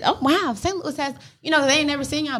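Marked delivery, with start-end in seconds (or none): 0.0-1.1 excited. Retold or imitated. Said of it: "will come here and think".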